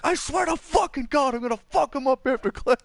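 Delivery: hammed-up enraged teacher vow; teacher slamming the table, mock furious. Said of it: "he slams his hand on the table".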